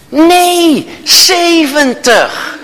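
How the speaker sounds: high, very slow, laughing mock cop; officer correcting the speeding driver, high and drawn out; smug cop correction. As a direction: drag out the number like a gloating officer.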